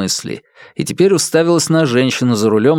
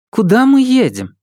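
insistent louder question; 11 semitones higher, 4 dB louder than usual. A narrator's reading. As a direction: ask again, higher and louder. Repeated, more insistent question.

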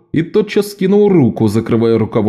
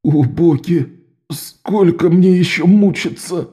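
moaning in agony; 7 semitones higher, slower than usual. Acted. Лок стонет от мучений.

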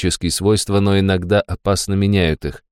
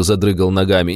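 ordinary delivery

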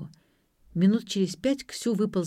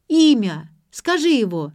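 loud, raised-pitch demand; insistent; 4 semitones higher, 9 dB louder than usual. A narrator's reading. Daughter presses father for grandmother's name, insistent. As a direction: press hard for the answer.

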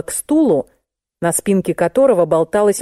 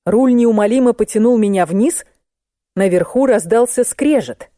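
ordinary delivery, nothing marked

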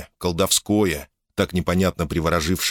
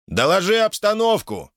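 high, drawn call through cupped hands; 13 semitones higher than usual. A narrator's reading. Hands cupped, shouting to the robot, high call. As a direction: call up to someone far off. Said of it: "сложив рупором ладони, крикнул ему".

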